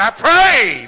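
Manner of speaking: high, loud pleading cry of the rich man; preacher wailing the rich man's plea; preaching at full force. Acted. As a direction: cry it up as a man begging from hell. Voicing the rich man in hell.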